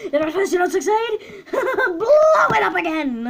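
manic laughing villain, rising to a high, loud shout; Junkrat. Acted. Streamer doing a best impression for viewers, manic and cackling. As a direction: cackle through it, building to a drawn-out yell. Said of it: "I'll do my best Junkrat impression".

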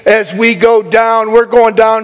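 high-energy sermon, ringing out promises; fervent preaching. Real, not acted. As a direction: preach it with rising conviction and punched key words. Preaches at full force.

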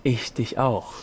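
low, soft murmur; whispered reply. Acted. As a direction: answer the love confession under your breath. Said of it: "flüsterte ich logischerweise zurück".

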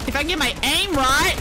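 ordinary delivery

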